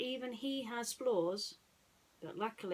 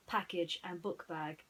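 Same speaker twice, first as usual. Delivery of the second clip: usual speaking voice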